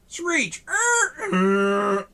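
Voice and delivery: high squealing tire screech; squealing tires sound effect. Acted. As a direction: shriek it like brakes.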